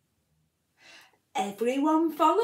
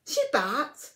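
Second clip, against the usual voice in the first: ordinary delivery, nothing marked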